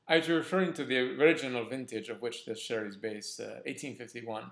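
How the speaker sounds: self-admittedly bad British accent, lightly put on; a hammed-up, amateur British accent for the quote; terrible Bond accent. Wine reviewer quoting Bond's comeback to M, in a bad British accent. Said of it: "I know I do a terrible accent".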